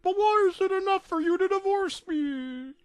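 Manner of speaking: Mickey near tears. Acted. Mickey pleading with Minnie, high and tearful. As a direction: squeak it out, close to crying. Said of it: "on the verge of tears".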